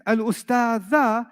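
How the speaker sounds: stretched Arabic with the final u lengthened; slow careful reading. Shown as a demonstration. Teacher reading the second example slowly. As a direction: read it slowly, word by word. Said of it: "second sentence".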